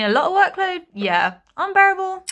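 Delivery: loud, squeaky-high comic protest; playfully exaggerated high, loud voice; joking high-pitched concession. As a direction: jump up high and loud, mock-overwhelmed. Jokingly concedes the workload myth.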